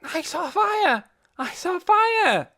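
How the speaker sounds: put-on high, slow, loud little girl cry; excited child shouting. A narrator's reading. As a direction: call out urgently like a small child. Poppy galloping up shouting.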